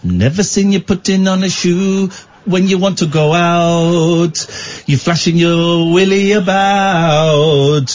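slow crooning mock Jamaican accent; comedy Jamaican accent. Host serenades Catherine in a mock Jamaican accent. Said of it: "the comedy Jamaican accent".

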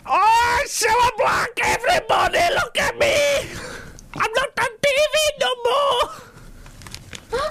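extreme falsetto mock wail; high, shrieking celebrity impression, last line drawn out. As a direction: squeal it very high in hammed-up Scouse.

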